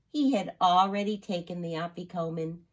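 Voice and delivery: plain reading voice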